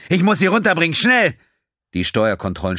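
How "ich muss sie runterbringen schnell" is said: higher, louder alarmed shout. A narrator's reading. Quinn alarms the crew as the engine fails, shouting. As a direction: scream the warning over the failing engine. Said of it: "schrie Quinn".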